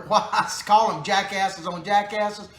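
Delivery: ordinary talk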